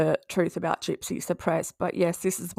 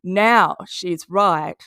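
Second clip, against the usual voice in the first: slow, louder stress on "Now"; sarcastic stress; 2 semitones lower, 4 dB louder, much slower than usual. Real, not acted. Sarcastic point about Katie's flip.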